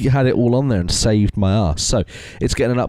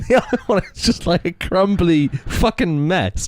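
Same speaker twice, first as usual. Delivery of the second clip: laughing through the words; giggling, slow and breathy; 6 semitones higher than usual. Real, not acted. Laughing at crushed chocolate bar.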